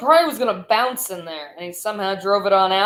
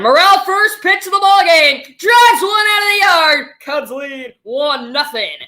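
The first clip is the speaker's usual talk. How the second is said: big excited home run call, much higher and louder; home run shout; 7 semitones higher, 5 dB louder than usual. Real, not acted. Play-by-play caller roaring a first-pitch homer. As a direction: belt it out as the ball leaves the yard.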